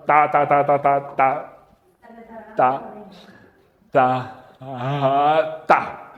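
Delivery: halting, broken repeated "ta" like a stammer; mock stutter on "ta, ta, ta"; imitating a stutter. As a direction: stammer out each "ta" with gaps between. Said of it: "Moisés seguramente era tartamudo".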